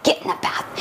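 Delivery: hushed, breathy yell with no voice; whisper yell. Retold or imitated. Mom to overtired kid at bath time late at night, whisper yelling. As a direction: yell it under your breath. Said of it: "trying to yell at your kids and, like, not be loud".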